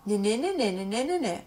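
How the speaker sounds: lightly voiced nasal na-na chant; sing-song na syllables like her son; imitating son's sign sounds. Mother imitating son's girl signal, falling na-na. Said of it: "That means it's a girl".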